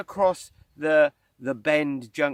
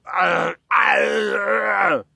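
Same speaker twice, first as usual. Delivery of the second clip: loud, higher-pitched wordless roar of rage; exploding in mock road rage; 6 semitones higher, 10 dB louder than usual. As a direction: roar out your frustration, long and loud. Demonstrates his road-rage outburst.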